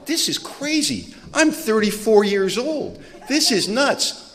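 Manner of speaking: high, slow exasperated grumbling to himself; exasperated self-talk. Retold or imitated. Man fed up driving between apartments, high slow exasperation. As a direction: drag it out, fed up with the long drive. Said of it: "I'm doing this going".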